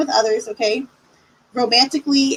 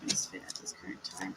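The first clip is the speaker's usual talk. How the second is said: slow, hushed spirit invocation; 4 dB quieter than usual. Acted. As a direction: breathe out the call to spirits. Calls on spirit guides.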